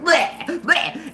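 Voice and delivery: mock throwing-up noise; short cartoonish retches; imitated retching. Friend imitates man hugging the toilet, mock retching. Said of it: "he is hugging the toilet".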